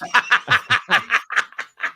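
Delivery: real laugh breaking out; big laugh. Real, not acted. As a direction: crack up at your own joke. Laughing at own Bears joke.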